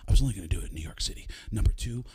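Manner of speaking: fast, urgent loud stage whisper; Baldwin whisper. Retold or imitated. Baldwin explaining himself to Pacino, whispered. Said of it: "And he goes".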